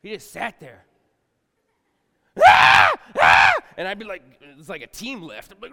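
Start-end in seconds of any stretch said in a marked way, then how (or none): 2.4-3.5 imitated baby cry. Fat baby crying.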